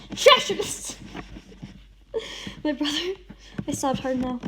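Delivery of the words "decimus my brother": high, loud stunned outcry; betrayed scream at the confession; shocked cry. A narrator's reading. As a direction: shout the name in disbelief.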